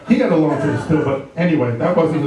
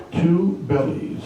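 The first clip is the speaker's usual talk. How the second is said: slow punchline; 4 dB quieter, much slower than usual. Acted. Doctor correcting the patient, slow deadpan. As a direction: say "two bellies" slowly, word by word.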